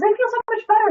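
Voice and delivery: put-on little boy. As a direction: squeal it like an overjoyed small boy. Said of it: "He goes".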